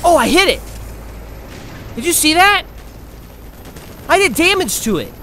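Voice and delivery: loud, high, thrilled exclamation; excited discovery. Real, not acted. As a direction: exclaim it, amazed at your own hit. Lands a hit on enemy.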